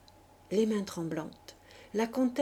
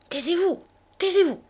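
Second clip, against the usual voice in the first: cri furieux, aigu et fort; 10 semitones higher, 10 dB louder, faster than usual. Acted. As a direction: hurler pour la faire taire, hors de soi. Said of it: "cria furieusement la comtesse". (la comtesse hurle de fureur pour faire taire Léonide)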